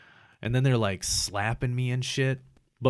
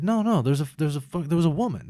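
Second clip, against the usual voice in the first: raised, higher retelling of an argument; insistent protest; 6 semitones higher, 5 dB louder than usual. Retold or imitated. Patient told there's no woman, higher insistent protest. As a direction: push back hard, voice up in pitch.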